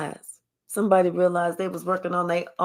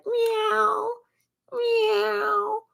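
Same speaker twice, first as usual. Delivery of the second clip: very high mock cat meow; high catcall meow; 14 semitones higher than usual.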